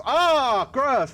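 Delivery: exaggerated yuck; hammed-up disgust. Real, not acted. Disgust at wearing pants.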